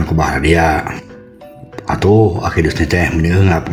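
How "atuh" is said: usual speaking voice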